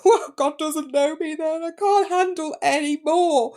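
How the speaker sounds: loud, high-pitched comic wail; mock crying. Overwhelmed patient answering her nurse, exaggerated crying. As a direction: wail it slowly, hammed-up tearful despair. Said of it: "I think I cried even more".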